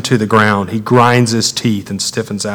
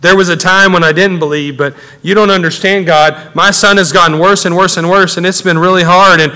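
raised voice climbing steadily higher; anguished pleading; 5 semitones higher, 4 dB louder than usual. Acted. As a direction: pour out your troubles to God, building in urgency. Acts father pouring out to God.

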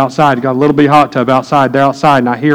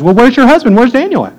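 a higher, lightly voiced friend asking where the husband is; 10 semitones higher, 3 dB louder than usual. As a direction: toss it up high, like a casual buddy looking around. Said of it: "he comes up to my wife and says".

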